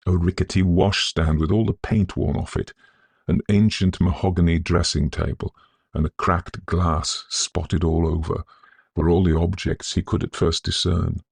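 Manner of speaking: plain reading voice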